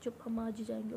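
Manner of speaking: फुसफुसाकर, दृढ़ता से; quiet but firm; hushed insistence. Retold or imitated. Jas overrules her plea. (धीमी, पक्की फुसफुसाहट)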